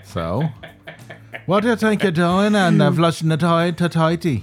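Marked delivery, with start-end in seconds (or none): 1.5-4.4 hammy bad Irish accent; high, loud mock Irish brogue; over the top irish accent. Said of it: "I'm gonna stick with this bad Irish accent".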